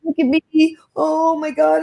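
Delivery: high, wailing imitation of tearful women; mock crying. Woman mocking emotional filter users, mock crying. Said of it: "then they're all crying".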